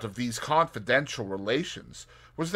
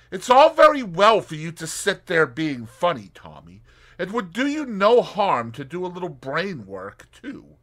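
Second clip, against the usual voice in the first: Tuppence voice; 8 semitones higher, 9 dB louder than usual. Acted. Tuppence scolding idle Tommy.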